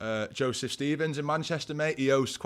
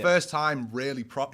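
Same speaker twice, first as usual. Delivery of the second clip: ordinary delivery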